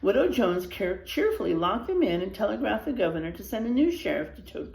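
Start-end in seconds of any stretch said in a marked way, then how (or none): none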